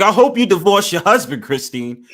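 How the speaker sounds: high, loud teasing jab; imitating the host's mean joke; mock host voice. Friend imitating the host teasing Christine, high and loud. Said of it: "it's like".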